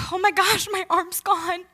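high, gasped disbelief; shocked realisation. Retold or imitated. Swimmer realising her arm is gone, shocked. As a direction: relive the stunned discovery, pitched high. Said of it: "I remember just in shock".

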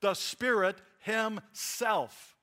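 slow and loud, "himself" drawn out word by word; each word separated, heavy weight on "himself"; emphatic stress. Shown as a demonstration. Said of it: "doesn't want you to miss that the subject".

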